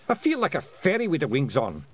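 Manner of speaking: high Scottish pictsie grumble; pictsie pilot. A narrator's reading. Crashed pilot complains in character.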